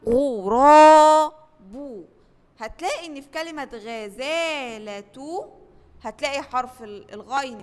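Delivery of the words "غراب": غراب slow and much louder; model word. Shown as a demonstration. Teacher to first graders, loud stretched model word. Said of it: "والضمة زي كلمة".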